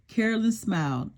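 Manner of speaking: ordinary delivery, nothing marked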